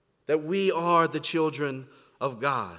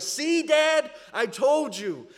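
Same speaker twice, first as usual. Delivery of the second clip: put-on excited kid; 9 semitones higher, slower than usual. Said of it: "they're like".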